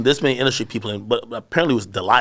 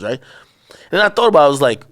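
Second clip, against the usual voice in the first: ordinary delivery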